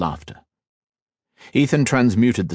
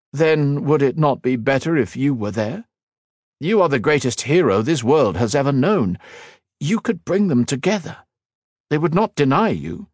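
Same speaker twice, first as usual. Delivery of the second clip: higher, coaxing and eager; persuading cousin; 7 semitones higher than usual. A narrator's reading. Cousin urges the hero to lead, eager and coaxing. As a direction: lift him up, talk him into it.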